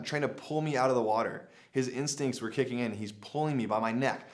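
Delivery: plain reading voice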